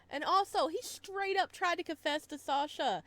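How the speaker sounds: loud, high, gushing; high, loud fangirl excitement; excited gossip. Real, not acted. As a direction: spill the news breathlessly. Excitedly recounts confession.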